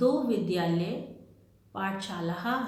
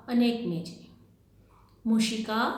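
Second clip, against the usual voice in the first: ordinary delivery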